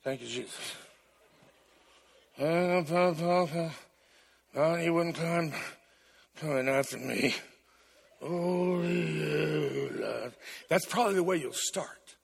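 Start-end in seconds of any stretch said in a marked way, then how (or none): 0.0-10.3 low, half-hearted murmur; grudgingly mumbled prayer; reluctant mumbling. Retold or imitated. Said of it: "you're gonna be like".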